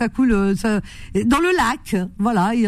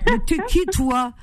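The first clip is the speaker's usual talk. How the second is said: a much higher, then slowed, mock-outraged challenge; imitating Khalid; 5 semitones higher, slower than usual. To her co-host, performing Khalid's key phrases for laughs. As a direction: ham up his catchphrases. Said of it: "On va imiter Khalid ce soir".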